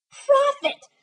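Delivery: high, loud desperate call; anguished cry. A narrator's reading. Narrator crying out to the raven in despair. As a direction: hurl the word at the bird. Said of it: "said I".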